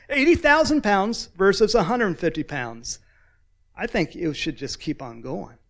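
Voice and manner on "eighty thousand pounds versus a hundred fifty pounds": high, loud incredulous exclamation; amused disbelief. Real, not acted. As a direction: marvel loudly at the absurd mismatch. Incredulous at the mismatch.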